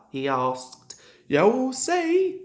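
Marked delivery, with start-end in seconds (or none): 1.3-2.4 higher, louder playful promise; smiling. A narrator's reading. Driver teasing Toby about the water, smiling. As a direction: tease with a smile in the voice. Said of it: "smiled the driver".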